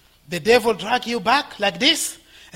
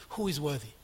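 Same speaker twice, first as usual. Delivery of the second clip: ordinary talk, nothing marked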